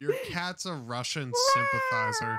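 high yell breaking into laughter; laughing shout. Real, not acted. Accusing the friend's cat of backing Russia, laughing shout. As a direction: shout it while cracking up.

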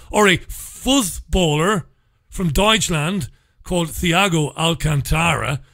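usual speaking voice

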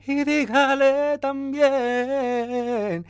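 breathy sung phrase; airy voice. Shown as a demonstration. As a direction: let air into the voice. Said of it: "Eso es pura voz con aire".

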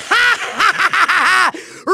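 scornful villain cackle; big mocking "ha ha ha"; evil laugh. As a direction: cackle in rapid, rolling bursts. Villain scoffs at the credits.

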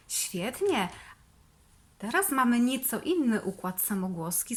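ordinary talk, nothing marked

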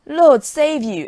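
loud, slow, high exclamation of wonder; exclaiming in awe. Acted. The soldier fakes amazement to fool the farmer, loud and slow. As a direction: drag it out, loud and marvelling. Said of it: "exclaimed Jack".

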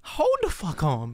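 normal talking voice

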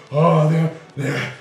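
ordinary talk, nothing marked

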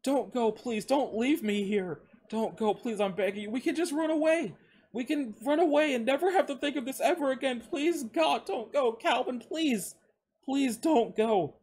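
high-pitched desperate pleading; distraught, wailing plea; cracking, tearful begging. A narrator's reading. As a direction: beg through tears, voice breaking. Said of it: "his voice cracking".

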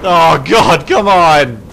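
loud, high pleading yell; frustrated shout. Real, not acted. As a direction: yell at the game. Pleading with game.

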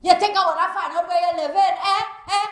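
angry Caribbean woman yelling curses at a man's house; furious cursing woman. Acted. Jilted woman outside Selwyn's house, furious yelling. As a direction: rage at him, loud and raw.

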